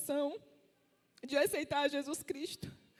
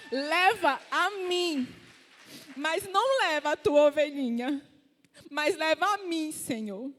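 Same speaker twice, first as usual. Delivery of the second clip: retold fervent prayer; 4 semitones higher, 4 dB louder than usual. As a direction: act out a husband crying out in prayer, stretching the words. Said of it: "meu marido tomou de voz".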